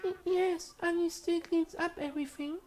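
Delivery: very high, meek stammering voice; timid maid voice. Acted. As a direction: speak like a shy servant girl.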